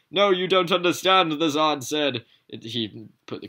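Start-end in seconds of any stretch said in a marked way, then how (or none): 0.1-1.8 high, loud protest; Zod's loud voice. Acted. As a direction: cry it out high as Zod. Zod voice retaken.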